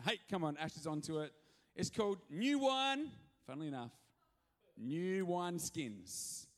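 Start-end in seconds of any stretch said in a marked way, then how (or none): 1.7-3.1 high, loud, slow title reveal; title announcement. Acted. Pastor announces the sermon title, slow and loud. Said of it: "announces sermon title". 4.8-6.5 very slow, drawn-out title; slow title reveal. Acted. Pastor spells out the title to the church, drawn out. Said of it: "announces sermon title".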